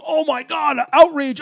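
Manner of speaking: high-pitched mock shock; mock public outrage. Imitating everybody looking up in a major city, mock outrage. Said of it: "everybody looking up in a major city and going".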